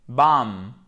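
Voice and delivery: BAM ending on a held M, no final B; B final muet. Shown as a demonstration. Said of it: "il n'y a pas de b à la fin". (Bam sans prononcer le B final)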